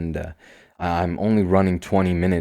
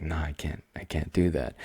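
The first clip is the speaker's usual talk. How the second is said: hushed inner refusal; 8 dB quieter than usual. Retold or imitated.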